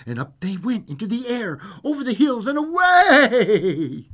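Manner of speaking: soaring narration. A narrator's reading. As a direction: sweep upward, stretching the last word. Reads fairy tale aloud.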